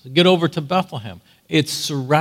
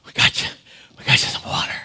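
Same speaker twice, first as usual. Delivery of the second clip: panting; faster than usual. Acted. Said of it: "They're panting".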